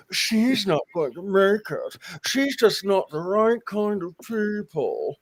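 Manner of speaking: slow clenched-jaw society snobbery; Locust Valley lockjaw. Retold or imitated. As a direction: sniff it through a locked jaw. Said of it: "the Locust Valley lockjaw".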